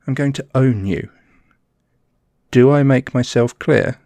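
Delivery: menacing. Acted. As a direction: demand obedience, rising force. Climaxing threat list.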